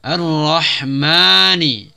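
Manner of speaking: slow drawn-out Ar-Rahmani, ra stressed; Quran recitation. Shown as a demonstration. Teacher demonstrates tajwid to students, slow recitation. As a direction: recite Ar-Rahmani slowly with a pressed ra. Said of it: "Ra tasydid ditekan langsung".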